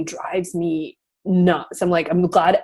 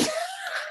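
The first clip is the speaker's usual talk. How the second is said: high laugh; 12 dB quieter than usual. Real, not acted. Laughs at her own comeback.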